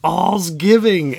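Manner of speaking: very high, loud, slow gleeful yell; aunt yells the holiday greeting joyfully. A narrator's reading. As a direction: bellow it with delight. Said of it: "Aunt Maisie yells".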